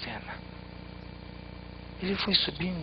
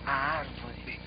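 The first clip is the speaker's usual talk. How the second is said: ordinary delivery, nothing marked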